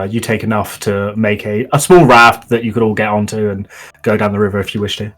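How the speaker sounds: ordinary delivery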